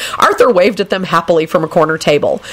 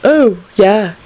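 put-on eager young man voice; 6 semitones higher, slower than usual. Neville orders food eagerly.

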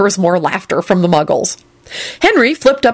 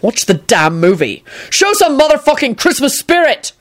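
grumpy command; 7 semitones higher than usual. A narrator's reading. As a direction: snap at them to behave. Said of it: "Quentin shouted".

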